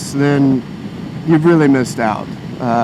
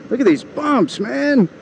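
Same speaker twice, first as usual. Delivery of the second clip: high, strained cry over bumps; exasperated; 9 semitones higher than usual. Real, not acted. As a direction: blurt it out as you're jolted. Jolted on a bumpy road.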